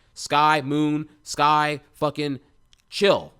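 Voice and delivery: urgent grounding call-out; loud self-calming. Retold or imitated. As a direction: shout the sky and moon at yourself to chill. Calming down during panic attack.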